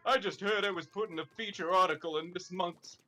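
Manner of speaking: usual speaking voice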